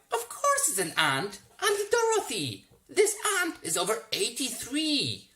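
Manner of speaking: put-on high, loud spoiled boy; bratty boy voice. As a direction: sneer it like a spoiled brat. Roy answers the anteater.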